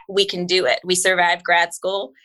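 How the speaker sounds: normal talking voice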